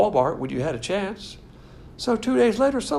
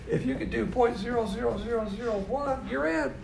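laughing; 6 semitones higher, 6 dB quieter than usual. Real, not acted. Laughing at tiny faith.

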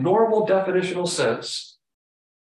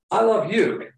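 higher-pitched, eager "I love you"; excited stress on YOU; 5 semitones higher, 3 dB louder than usual. Shown as a demonstration. Preacher acting out attraction to the object, excited stress on YOU. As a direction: say "I love you" high and keen, leaning into YOU. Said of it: "Very excited about the object".

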